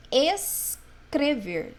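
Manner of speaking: escrever very slow, holding ES, then CREVER; S and C separated. Shown as a demonstration. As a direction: split escrever after a long hissed S. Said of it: "eu fiz o som do S e do C". (escrever bem devagar, separando o S do C)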